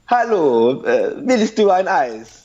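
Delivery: high, loud Italian sing-song in German; Italian-accented German. Acted. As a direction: exaggerate the Italian melody on German words. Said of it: "the guys are like".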